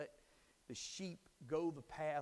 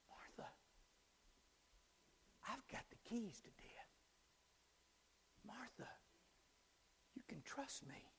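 hushed, unvoiced tender whisper as Jesus; Jesus whispering comfort to Martha; intimate whisper; 9 semitones higher, 11 dB quieter than usual. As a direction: whisper reassurance close in.

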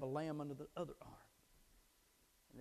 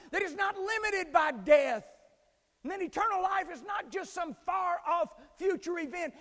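shouting, much higher fiery sermon voice; thundering revival preacher; full-force preaching; 16 dB louder than usual. Acted. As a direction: preach it to the rafters.

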